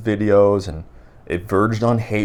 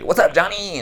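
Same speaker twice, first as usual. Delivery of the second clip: much higher, excited call-out; hyped greeting; 12 semitones higher, 4 dB louder than usual. Acted. Mocking edited YouTube videos, hyped greeting. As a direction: shout a hyped-up hello, pitched way up.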